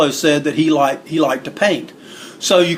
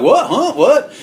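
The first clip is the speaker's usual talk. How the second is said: high, startled mock confusion; imitated dimwit's high-pitched questioning; bug-eyed bewilderment; 9 semitones higher, slower than usual. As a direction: blurt it like you've been caught clueless. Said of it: "you know, bug-eyed, like".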